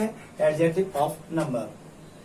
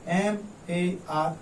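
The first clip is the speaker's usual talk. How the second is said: ordinary talk, nothing marked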